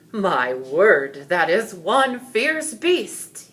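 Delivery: loud, slow amazed royal voice; astonished king. A narrator's reading. King gasps at the fierce dragon, loud and slow. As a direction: marvel at the monster. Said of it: "he said".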